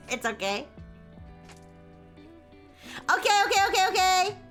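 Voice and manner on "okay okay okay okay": high, bright cute greeting voice; cute anime streamer. Real, not acted. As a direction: squeal hellos to the raiders. Greeting raiders again.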